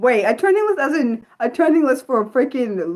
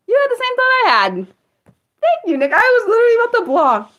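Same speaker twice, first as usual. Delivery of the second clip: high, loud burst of excitement; thrilled someone had the same thought; excited agreement with a viewer; 13 semitones higher, 5 dB louder than usual. Real, not acted.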